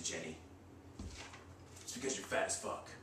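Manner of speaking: ordinary delivery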